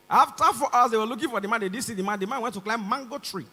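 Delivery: ordinary delivery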